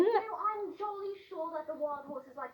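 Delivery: hammed-up jolly British voice; overdone mock British chirpiness; put-on British accent. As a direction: fake a very proper British speaker. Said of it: "supposed to be a British accent".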